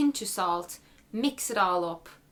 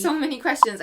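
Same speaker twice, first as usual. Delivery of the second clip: overwhelmed exclaim; 8 semitones higher, 9 dB louder than usual. Real, not acted. Mock overwhelm.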